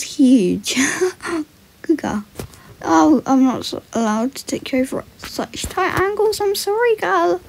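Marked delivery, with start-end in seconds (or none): none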